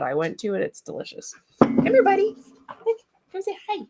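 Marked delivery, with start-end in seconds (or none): 1.6-3.8 much higher coaxing call; high, excited come-here voice; calling the puppy. Real, not acted. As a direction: coax the dog over to the camera.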